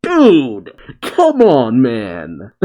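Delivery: drawn-out complaint falling away at the end; mock exasperation. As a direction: plead in amused exasperation. Voicing Luffy's exasperation amid laughter.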